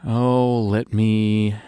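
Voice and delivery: ordinary delivery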